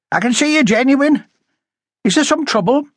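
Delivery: high character voice, inquiring; high-voiced householder. A narrator's reading. Woman asking police if there is trouble, high-voiced. Story character, Miss Graham.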